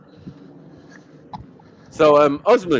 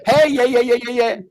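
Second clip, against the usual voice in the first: mock excited hey; 10 semitones higher, 3 dB louder than usual.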